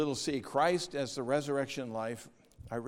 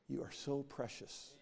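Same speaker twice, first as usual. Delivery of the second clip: soft loving prayer; 2 semitones higher, 10 dB quieter than usual. Retold or imitated. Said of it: "don't say".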